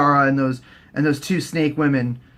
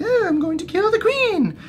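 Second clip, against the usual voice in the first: very high mock girl's voice; offhand Arya. Arya telling soldiers she'll kill the queen, high and offhand. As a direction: pitch it way up, casually announcing it. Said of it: "she's all like".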